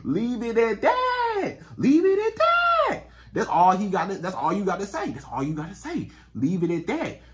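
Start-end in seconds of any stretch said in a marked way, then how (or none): 0.0-1.6 high, slow holler; drawn-out call. Pretend. Repeats the catchphrase as holler. 1.8-3.0 very high, loud, slow holler; drawn-out shout. Pretend. Repeats the catchphrase as holler.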